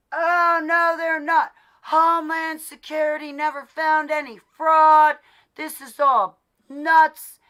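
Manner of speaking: high, loud sneering imitation of a know-it-all; mock know-it-all voice; mocking Fox viewer. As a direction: parrot him with a mocking lilt.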